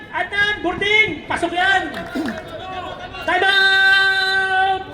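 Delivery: excited basketball commentary; live play-by-play. Real, not acted. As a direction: courtside announcer in full flow.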